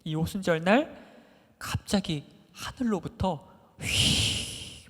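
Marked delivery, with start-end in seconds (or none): 1.6-4.8 a slow, drawn-out whoosh of wind; imitated wind whoosh. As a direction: blow the sound out like a rushing wind. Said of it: "강한 바람 소리가". (느리게 끄는 바람 소리 흉내)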